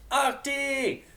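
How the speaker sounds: high, loud fake-cheery call; mock enthusiastic shout. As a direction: shout the nickname with fake delight. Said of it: "shouted with mock enthusiasm".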